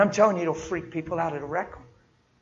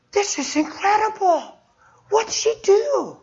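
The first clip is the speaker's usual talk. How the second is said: higher and slow, wide-eyed wonder; amazed; 14 semitones higher, 3 dB louder, slower than usual. Retold or imitated. Healed lady asking the preacher what the girl did, amazed. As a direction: marvel at it slowly.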